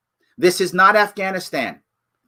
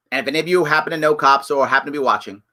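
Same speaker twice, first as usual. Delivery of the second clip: ordinary talk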